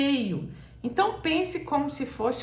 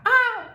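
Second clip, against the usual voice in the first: "ah" agudo e forte, como eco do chamado; grito de A; 13 semitones higher, 7 dB louder than usual. Shown as a demonstration. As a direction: grite só a vogal tônica. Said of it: "só a letra A". (grito agudo de "a" sustentado)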